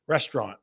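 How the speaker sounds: restaurant said as "restrahn", middle vowel dropped, no final t; his own way of saying restaurant. Shown as a demonstration. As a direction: say restaurant as "res-trahn", nasal ending. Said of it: "Listen to what I say".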